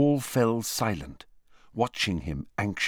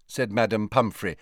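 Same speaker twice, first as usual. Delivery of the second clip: ordinary delivery, nothing marked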